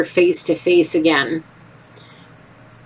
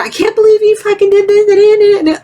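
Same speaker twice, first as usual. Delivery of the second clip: angry outburst; 14 semitones higher, 5 dB louder than usual. Retold or imitated. Somebody standing over, scolding.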